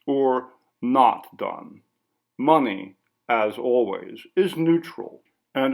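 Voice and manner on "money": plain reading voice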